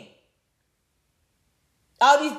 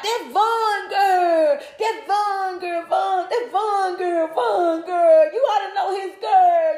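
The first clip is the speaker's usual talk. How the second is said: high, drawn-out mocking sing-song with laughs; laughing mockery; 5 semitones higher, slower than usual. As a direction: repeat it like a joke you can't believe.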